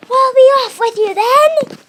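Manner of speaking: very high, very loud, slow shout; crocodile yelling. Acted. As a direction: stretch the dismissal into a huge bellow. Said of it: "and he yelled".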